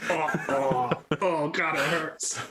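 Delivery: high hammed-up moaning cries; wounded soldier gasping. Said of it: "I hear a lot of".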